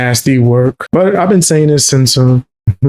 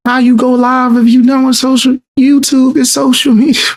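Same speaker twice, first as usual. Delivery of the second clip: incredulous shout; 10 semitones higher than usual. Real, not acted. Calling out the contradiction.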